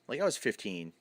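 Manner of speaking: ordinary talk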